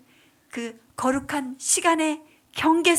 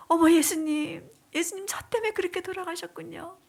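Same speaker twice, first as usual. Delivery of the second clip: high, quick, weeping cry of discovery; retold crying prayer, pitched up; tearful realization; 5 semitones higher than usual. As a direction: gasp in grieving surprise. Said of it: "다시금 눈물을 흘리면서".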